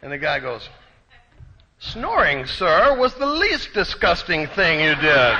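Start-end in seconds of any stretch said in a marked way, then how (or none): 1.8-5.4 lofty, scornful reply, pitched higher and louder; haughty put-down. Retold or imitated. Waiter sneering at the snoring passenger, haughty. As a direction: look down your nose at him.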